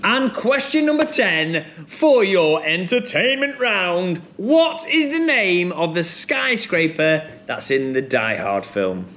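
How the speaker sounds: high, drawn-out quiz host delivery; quizmaster announcement. Acted. Host reading question ten to the room, showy quizmaster announcement. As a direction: announce the question with big swoops in pitch.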